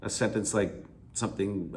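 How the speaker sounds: ordinary talk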